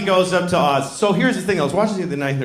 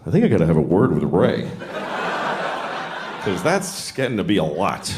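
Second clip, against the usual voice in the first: director Victor Fleming; 11 semitones lower than usual. Retold or imitated. Director worrying about Ray, low dry aside. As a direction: mutter it like a weary director. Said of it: "he was like".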